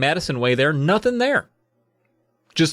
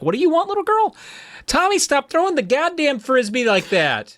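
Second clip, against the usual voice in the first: harried mom; 14 semitones higher than usual. Retold or imitated. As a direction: split between the kid and Tommy. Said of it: "she's like".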